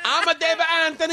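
very high cartoon Italian accent; hammed-up Italian self-introduction, sky-high; mock Italian accent. Plays comic with mock accent.

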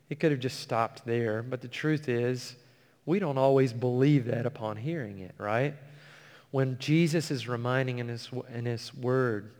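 ordinary delivery

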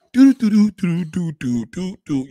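sung hold-music tune; imitating hold music; hold music hum. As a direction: hum the little phone music.

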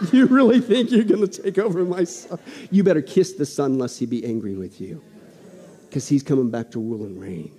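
low, scornful voice of God; voicing God. God scoffing at men who plot against his son, low. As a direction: talk down to the scheming rulers. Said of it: "God is up in heaven laughing at you guys going".

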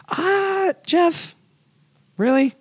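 high, drawn-out disbelief; dismayed. Real, not acted. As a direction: whine it in mock dismay. Dismayed at snowflake fact.

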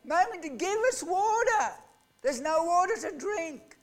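put-on high, thin child's voice begging for water; pleading children. Thirsty child begging mother for water, in a high pleading voice. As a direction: voice a thirsty child crying to mummy. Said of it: "What are the children saying?".

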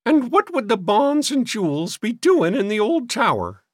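high, scornful cracked voice; querulous old maid. A narrator's reading. Sister questioning the boys' story, scornful. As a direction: challenge them in a high fussy voice.

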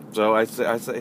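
usual speaking voice

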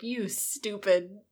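slow, drawn-out mock insult; hammed-up childish scolding; mean Snape. Snape coming up to insult Harry, mock-mean. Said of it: "Snape coming up to Harry".

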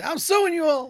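high, laughing mock threat; gleeful sliding shopper. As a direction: squeal it while sliding away.

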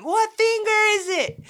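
high, loud frantic question yelled back; retold scream. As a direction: yell over the noisy bus. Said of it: "I'm, like, screaming".

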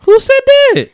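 very high, loud mock outrage; high-pitched playful demand; mock indignant challenge. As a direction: squeal the question in put-on disbelief. Mock challenge to unnamed doubters.

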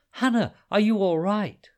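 high, loud worried call; anxious shout. Acted. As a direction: call out in worry. Hudayfa calls anxiously.

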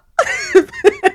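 loud high burst of laughter; hearty laugh. Real, not acted. Host laughs at own joke.

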